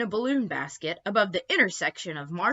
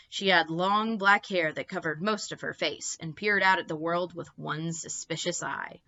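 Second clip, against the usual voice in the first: ordinary delivery, nothing marked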